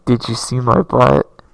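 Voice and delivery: yelled at full volume; a dopey, booming Patrick shout; loud shout. Acted. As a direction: bellow it out proudly. Patrick reappears, clueless.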